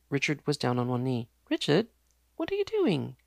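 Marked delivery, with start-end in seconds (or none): 1.5-3.2 high shocked disbelief; appalled. Acted. Fiancée sees Richard kneel, shocked and high. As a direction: recoil at what he's doing.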